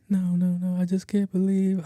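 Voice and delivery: high, whiny mock wail; imitated crying. Friend refuses his offer of help, mock crying. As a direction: protest through put-on tears. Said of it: "Called me crying".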